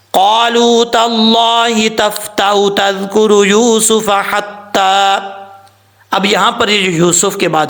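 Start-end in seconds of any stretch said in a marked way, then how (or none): 0.1-5.2 slow, high, melodic tajweed chant; Quran recitation. Shown as a demonstration. Teacher reciting the verse to students, slow high chant. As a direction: draw out each word like a recitation.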